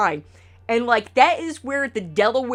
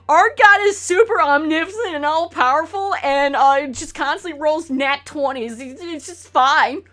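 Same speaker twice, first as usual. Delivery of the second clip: mock smug believer; 5 semitones higher, 4 dB louder than usual. Mocking Christians' smug view of God.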